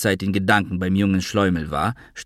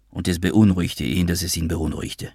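usual speaking voice

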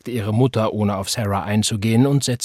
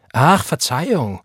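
slow, higher mock apology; shrill little-girl tone; 5 semitones higher, 4 dB louder than usual. A narrator's reading. Roy needling his brother, shrill little-girl mockery. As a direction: taunt him in a put-on little-girl voice. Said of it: "in dem schrillen Kleinmädchenton".

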